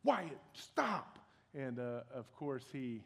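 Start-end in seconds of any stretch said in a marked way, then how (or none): 0.0-1.2 sudden high snapped command; exasperated parent's sharp outburst; snapping at child. Retold or imitated. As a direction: snap at a small child to stop, pitch jumping up. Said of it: "I snapped at him".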